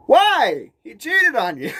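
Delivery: incredulous. Retold or imitated. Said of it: "I'm like".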